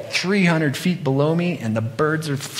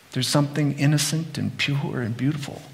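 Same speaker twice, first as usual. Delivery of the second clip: ordinary delivery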